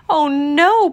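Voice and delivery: high, loud exclamation; dismayed cry. A narrator's reading. Pooh watching the bug escape, high cry. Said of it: "Pooh cried".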